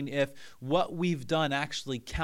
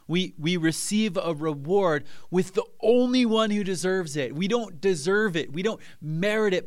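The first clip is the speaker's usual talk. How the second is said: higher, louder impassioned preaching; fervent preaching; 4 semitones higher, 4 dB louder than usual. Real, not acted. Pastor urging the congregation, impassioned preaching. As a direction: preach it with rising fire.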